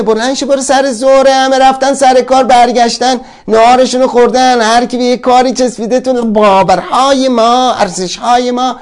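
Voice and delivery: high, loud nagging tirade; shouting inner voice. Acted. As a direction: yell it at yourself like a nagging mind.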